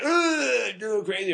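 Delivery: high-pitched goofy groaning imitation; Robert Downey. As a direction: ham up a wild, goofy whine. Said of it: "then Robert Downey's like".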